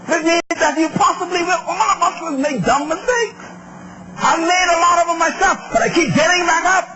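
high-pitched, insistent sermon delivery; impassioned preaching. Acted. Preacher admitting his own mistakes to the congregation, impassioned preaching.

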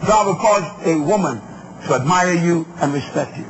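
usual speaking voice

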